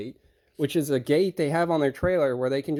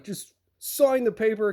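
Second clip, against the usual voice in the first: higher, impatient mock order; imitated irate driver; 5 semitones higher than usual. Irate driver demanding signed paperwork, imitated irritation. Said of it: "he gets irate".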